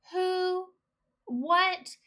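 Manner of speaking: high-pitched, puzzled imitation of mom; baffled mom. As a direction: act out mom not getting it at all. Mom baffled by daughter's excitement.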